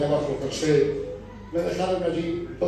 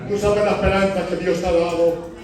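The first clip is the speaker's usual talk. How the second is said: usual speaking voice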